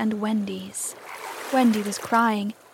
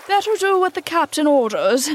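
higher, louder nervous urging; 6 semitones higher, 5 dB louder than usual. A narrator's reading. Starkey urging his mate to obey, nervous. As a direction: urge it nervously, pitch raised. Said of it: "said Starkey nervously".

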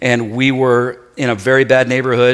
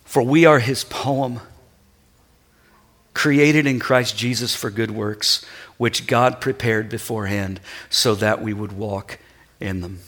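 scripture reading; 4 semitones lower, 6 dB quieter than usual. Acted. Reads scripture softly, slowly.

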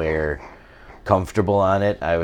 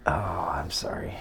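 soft whispered sorry; whispering; 4 semitones lower, 11 dB quieter than usual. Real, not acted. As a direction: breathe the apology out. Whispered mock apology.

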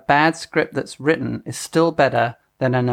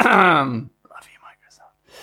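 hushed, playful whisper; whispered aside; 4 semitones higher, much slower than usual. Pretend. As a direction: whisper it as a sheepish sweet nothing. Hushed aside to employer after joking.

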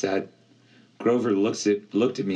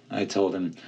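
ordinary delivery, nothing marked

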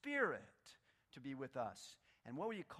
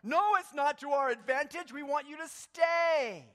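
Peter protesting; 8 semitones higher, 10 dB louder than usual. Retold or imitated. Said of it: "about to pipe up and go".

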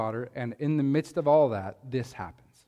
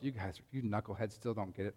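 thinking to himself; 5 semitones lower, 13 dB quieter than usual. Retold or imitated. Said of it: "he thinks to himself".

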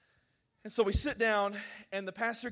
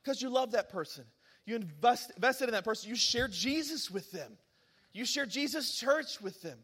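usual speaking voice

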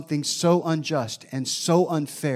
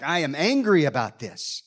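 ordinary talk, nothing marked

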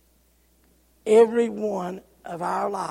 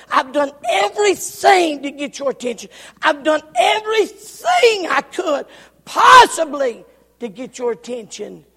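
higher, louder, building to a shout; preaching at full force; 5 semitones higher, 8 dB louder than usual. Acted. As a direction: preach it, pleading and rising. Preacher voicing pleading God.

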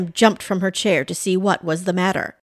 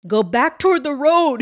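higher, mildly shivery urgency; teeth chattering; 6 semitones higher, 3 dB louder than usual. A narrator's reading. Santa in icy water directs Pam, teeth chattering. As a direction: urge her on as if chilled. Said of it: "teeth chattering".

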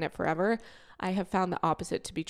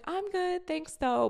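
fake cheerful brush-off; 12 semitones higher, slower than usual. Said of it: "I'm just like".

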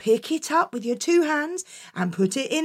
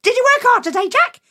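high, loud, eager mum; delighted mum; 12 semitones higher, 6 dB louder, faster than usual. Acted. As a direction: gush over him, quick and pleased. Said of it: "she said".

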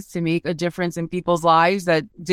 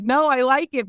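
much higher, insisting as in a retold chat; retold insistent reassurance; 8 semitones higher than usual. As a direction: reassure a client, pitched well up. Retold reassurance to crying client.

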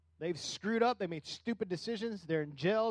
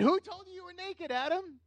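God questioning Adam; 11 semitones higher than usual. Retold or imitated. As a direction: ask like a father who already knows. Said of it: "What did he do?".

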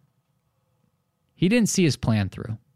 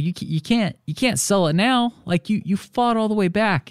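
incredulous plea; 9 semitones higher than usual. Retold or imitated. Pleading with client not to sell.

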